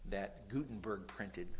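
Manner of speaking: ordinary talk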